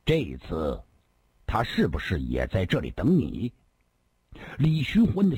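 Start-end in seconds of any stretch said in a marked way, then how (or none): 0.1-3.5 low, restrained cold question. Acted. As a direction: ask it coldly, pausing after the opening words. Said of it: "他只冷冷地问道". (冷冷地低声质问)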